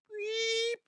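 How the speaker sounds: a tiny, very high, soft beep of a whistle; timid whistle. Retold or imitated. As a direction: peep it out like a shy whistle. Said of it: "the most timid whistle ever".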